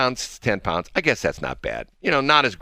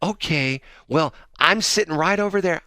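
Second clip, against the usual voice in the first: high, lightly put-out but friendly; lady impression; 9 semitones higher than usual. Host voicing the lady after he declines prayer, high imitated voice. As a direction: imitate a stranger pointing out her seat. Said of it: "And she says".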